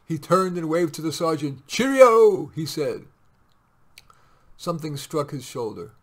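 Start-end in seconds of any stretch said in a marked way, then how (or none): 1.7-2.5 high, loud jaunty goodbye; loud cheery farewell. A narrator's reading. Captain waving to sergeant at the door, cheery call. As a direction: call it out breezily.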